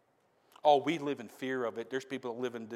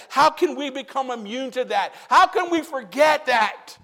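full-force preaching; 14 semitones higher, 16 dB louder than usual. Real, not acted.

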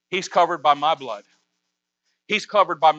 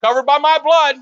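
higher and louder declaration; Jesus defends Kevin, loud and higher; bold claim; 7 semitones higher, 7 dB louder than usual. Pretend. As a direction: ring it out triumphantly.

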